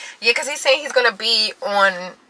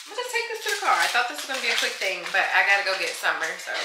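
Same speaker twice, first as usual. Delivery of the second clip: ordinary talk, nothing marked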